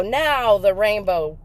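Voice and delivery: ordinary talk, nothing marked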